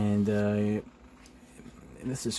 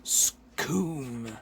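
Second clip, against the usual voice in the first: higher, whooshing comic-book sound effect; imitated blast; 5 semitones higher than usual. Narrator showing Shriek's second blast, higher imitated whoosh. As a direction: make the noise of a blast going off.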